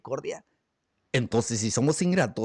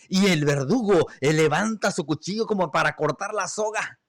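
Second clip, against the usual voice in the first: ordinary talk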